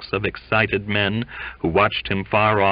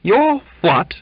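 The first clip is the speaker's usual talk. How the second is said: high, loud, disbelieving question; outraged echo, pitched way up; incredulous; 14 semitones higher, 7 dB louder than usual. A narrator's reading. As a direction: throw it back in disbelief. Mate's incredulous interruption.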